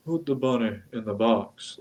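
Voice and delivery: lightly put-on Southern accent, soft; a quick mock Southern accent on a movie line. Friend imitating Nick Cage's Southern accent, soft and light. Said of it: "He could do the Southern accent".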